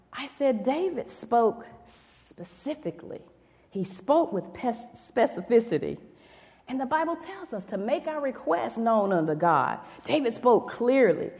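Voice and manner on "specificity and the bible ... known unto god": ordinary talk, nothing marked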